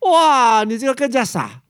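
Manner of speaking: loud, high "哇" of astonishment; astonished wow. Acted. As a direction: burst out in amazement. King astonished again. (惊叹地高喊一声"哇")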